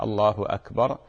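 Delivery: أكبر with a clear glottal stop, "Allahu Akbar"; الله أكبر spoken with the hamza cut cleanly; correct hamza. Shown as a demonstration. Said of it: "بهمزة القطع".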